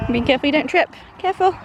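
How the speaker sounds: high, lilting care. Real, not acted. Mum warning and coaxing toddler, high lilting baby talk. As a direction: lilt upward, coaxing a little one.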